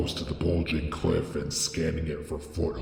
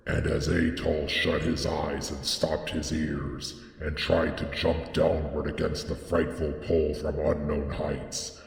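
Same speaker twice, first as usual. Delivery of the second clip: usual speaking voice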